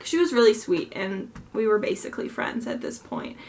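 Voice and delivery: ordinary talk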